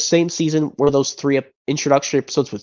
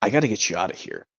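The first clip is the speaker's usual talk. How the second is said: urgent hush; 5 semitones lower, 6 dB quieter, faster than usual. Acted. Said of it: "another impression from this movie".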